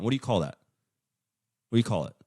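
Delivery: normal talking voice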